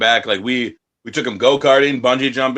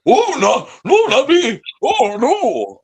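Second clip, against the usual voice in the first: high, mocking voice of a timid wrestler; mocking prissy refusal; 13 semitones higher, 3 dB louder, much slower than usual. Wrestlers refusing a joint before a match, high mocking squeal. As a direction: squeak it out like a goody-goody turning down a joint. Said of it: "People are like".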